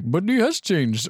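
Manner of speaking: mock movie Indian accent, stretched words. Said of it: "an Indian accent the entire movie".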